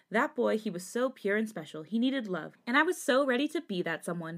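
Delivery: plain reading voice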